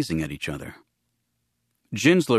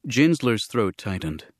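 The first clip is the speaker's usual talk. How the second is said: ordinary delivery, nothing marked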